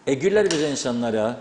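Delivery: normal talking voice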